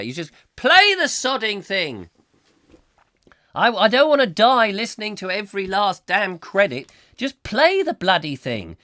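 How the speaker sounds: high, loud comic outburst; exasperated rant. Acted. Host rails at Alexa's endless credits, loudly exasperated. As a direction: lose patience, pushing pitch and volume up.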